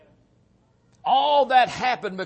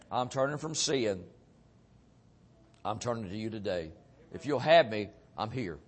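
humble prayer; 6 semitones lower, 5 dB quieter than usual. Retold or imitated. Surrender prayer to the Lord.

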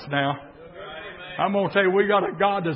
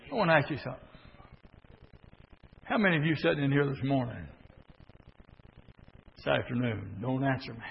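hushed; 7 semitones lower, 9 dB quieter, faster than usual. Real, not acted. Preacher quietly asking the congregation, hushed. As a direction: drop to a confiding hush. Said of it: "hushed confiding question".

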